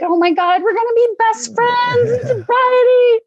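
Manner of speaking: high, squealed best-friend enthusiasm; mock giddy squeal; gushing excitement. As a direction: gush like brand-new besties, pitch climbing. Gushing retold excitement with new friend.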